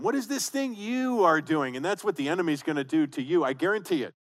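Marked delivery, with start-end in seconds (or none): 0.0-1.8 mock sneer, pitched higher. Pastor voicing the enemies mocking Nehemiah, sneering. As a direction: jeer it like a scornful enemy. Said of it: "They're mocking them".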